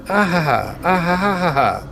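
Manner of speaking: high maniacal cartoon-villain cackle; Mandark laugh. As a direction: cackle up high like a mad genius. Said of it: "begins to maniacally laugh, like Mandark from Dexter's Laboratory".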